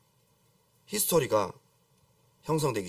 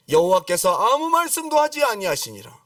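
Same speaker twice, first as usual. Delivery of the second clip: raised, louder proclamation of the verse; forceful scripture; 4 semitones higher, 5 dB louder than usual. Acted. Preacher repeating God's silence to the congregation, forceful proclamation. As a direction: declare it high and strong.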